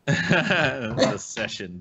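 dragged out syllable by syllable; very slow catchphrase. Pretend. Friend drawling the running gag slowly to the group. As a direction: stretch it out lazily.

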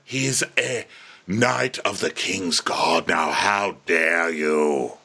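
slow, low, outraged scolding; stern father. Retold or imitated. Lionel rebuking his son in a slow, low voice. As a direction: drag it out like a stern lord.

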